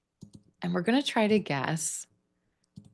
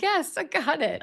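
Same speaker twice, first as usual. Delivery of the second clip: pleased click; 10 semitones higher than usual. Pretend. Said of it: "It was like".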